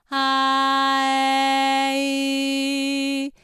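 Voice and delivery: slow, loud あー えーいー kept open; wide vowel chain. Shown as a demonstration. Voice teacher demonstrating open vowels. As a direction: sing あー えーいー wide and sustained. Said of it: "本当に広く作っていく感じ".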